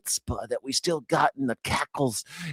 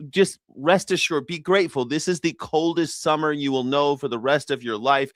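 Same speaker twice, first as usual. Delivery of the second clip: ordinary delivery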